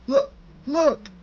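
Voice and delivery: winded clerk pleading; wheezing. A narrator's reading. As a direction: squeeze the words out with no air. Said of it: "Arlen managed to wheeze out".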